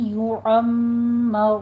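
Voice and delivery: یعمر drawn out very slowly with nasal ghunna; recited word. Shown as a demonstration. Teacher reciting یعمر as a tajweed model. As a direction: chant یعمر slowly with the doubled meem. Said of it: "این".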